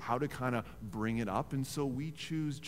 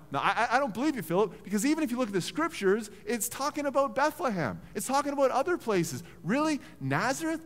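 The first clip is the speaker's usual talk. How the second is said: incredulous; 9 semitones higher, 4 dB louder than usual. Retold or imitated. Nathanael scoffs at Philip.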